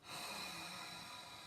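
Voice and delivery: long heavy sigh, demonstrating the wrong way; an exaggerated demo sigh; big sighing breath out. Shown as a demonstration. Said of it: "I don't want you to do that".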